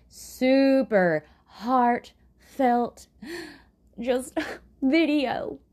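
mock country twang, stretched very slow with a gasp and a laugh; hammed-up country twang, very slow. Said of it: "I just did a country kind of twang accent there".

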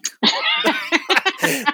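sudden high laugh at herself; high burst of laughter. Real, not acted. Laughing at her own blunt remark about the Bible. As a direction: let a bright laugh burst out.

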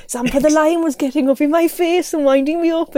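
deliberately bad Welsh accent. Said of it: "I'm gonna do a very bad Welsh accent here".

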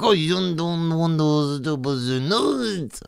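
high, slow hammed-up German accent; mock German accent. Comic imitating refused Germans, mock German accent. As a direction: stretch it out high in fake German. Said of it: "German people be like".

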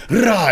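sudden high loud war cry; battle cry. Retold or imitated. Hyping up a friend over Zoro's big moment, roaring battle cry. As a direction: yell it like a swordsman attacking.

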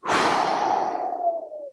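long calming exhale; second deep sigh. Real, not acted. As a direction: breathe it out again. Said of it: "Two deep breaths".

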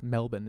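"Melbourne" with the second syllable reduced, no R; local pronunciation. Shown as a demonstration. Showing friends how Australians say the city, local pronunciation. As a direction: say "Melbourne" as MEL-bun. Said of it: "is how they say".

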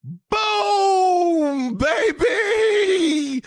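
high excited victory yell; triumphant shout. Real, not acted. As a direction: shout it out like you won. Triumph after spelling backwards.

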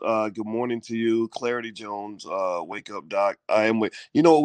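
ordinary delivery

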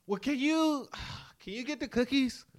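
imitated high, sighing plea; mock reluctant wheedle with a sigh; reluctant pleading. Imitating husband dodging pudding duty to wife, reluctant pleading.

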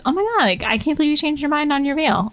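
put-on high, excited girly voice; excited gasp. Friend's excited reaction retold.

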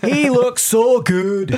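loud, high, drawn-out Irish swooning; Irish accent. Acted. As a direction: stretch it out in an over-the-top Irish accent. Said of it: "Ireland that are like".

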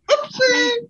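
squeaky Toasty voice. Retold or imitated. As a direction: shriek it cartoonishly. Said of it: "You don't remember?".

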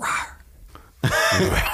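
very high, airy squeaky rawr; cute mock roar with the voice jumping way up; monster roar. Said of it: "and a little".

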